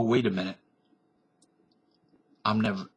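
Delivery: ordinary talk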